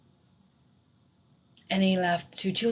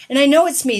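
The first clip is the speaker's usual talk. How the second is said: ordinary delivery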